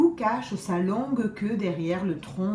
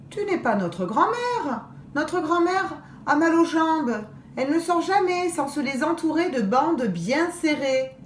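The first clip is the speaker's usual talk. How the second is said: put-on girl's voice; 7 semitones higher than usual. Second girl refuses wolf.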